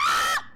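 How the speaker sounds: short startled scream of joy; real startled shriek; sudden scream. Real, not acted. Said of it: "sorry, I didn't mean to scream".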